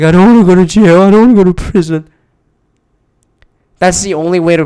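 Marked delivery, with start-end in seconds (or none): 0.0-2.1 high, louder, whiny fake blubbering; mock the shooter sobbing to the FBI, whining and high; mock crying. Mocking a crying shooter, whiny high voice. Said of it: "he started crying afterwards".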